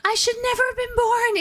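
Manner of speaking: very high squealing anguished wail; hammed-up anime meltdown; spastic wailing apology. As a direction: freak out and wail your apology to the world. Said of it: "And he's like".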